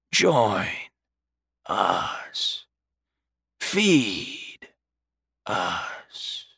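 slow, whispered ghost voices; ghostly whisper. A narrator's reading. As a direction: breathe it like the dead calling. Spirits of the dead call.

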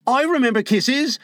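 put-on high, eager boy cutting in; Slightly. A narrator's reading. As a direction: interpose in a high boy's voice. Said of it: "Slightly interposed quickly".

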